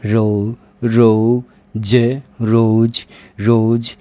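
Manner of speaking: रोज split into रो then ज; teacher breaking रोज into parts; sounding out slowly. Shown as a demonstration. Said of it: "तो वो क्या होगा आपका?".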